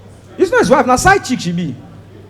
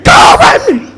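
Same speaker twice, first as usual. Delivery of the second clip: loud, high exasperated shout; 14 semitones higher, 5 dB louder than usual. Acted. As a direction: belt it out at the crowd. Comedian urging men to cry.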